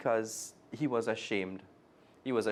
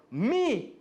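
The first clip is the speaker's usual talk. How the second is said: eager claim; 13 semitones higher, 5 dB louder than usual. Acted. Children arguing over batting.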